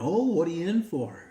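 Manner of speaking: a chatty dog sizing up his neighbour; curious dog voice. Acted.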